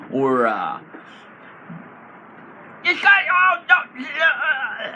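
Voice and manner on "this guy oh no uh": high loud stammer; stuttering shout. Acted. Goblin bard stammering mid-taunt, high loud stutter. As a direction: trip over the words on purpose. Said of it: "and then stutter".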